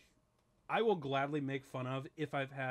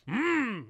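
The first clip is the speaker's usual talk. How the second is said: high, loud yummy mmm; a pleased yum hum; tasty yum mmm; 10 semitones higher, 9 dB louder than usual. Real, not acted. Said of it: "You made a yummy sound".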